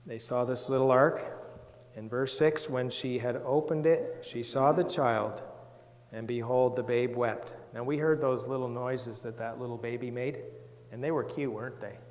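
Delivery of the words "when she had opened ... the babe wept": put-on measured Bible reading for children; scripture reading. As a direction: read the verse slowly to the class. Reads the Bible aloud to children.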